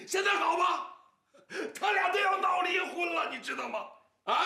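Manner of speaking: shrill angry rebuke; furious shout. Acted. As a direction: explode at her in blame. Father keeps scolding.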